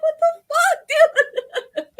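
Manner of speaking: long, high, loud wail of disbelief; hammed-up squealed outrage; mock exasperation. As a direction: stretch it out slowly, high, through laughter. Retells exasperated reaction, laughing.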